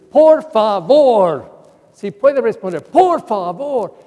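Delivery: loud, slow mock nagging nun; exasperated pleading. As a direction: plead like a fed-up old nun. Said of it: "su modo era decir".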